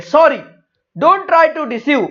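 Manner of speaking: high, loud, slow rebuff; stern rebuff. Acted. Others rebuff the pleading belly. (ऊँची आवाज़ में सख़्त इनकार)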